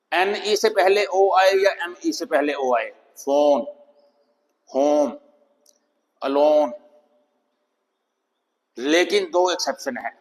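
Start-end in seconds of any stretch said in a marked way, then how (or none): 3.2-6.7 फोन, होम, अलोन with a long rounded O; long O sound. Shown as a demonstration. Teacher giving word-ending examples, long O. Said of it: "अब लंबा हो गया sound".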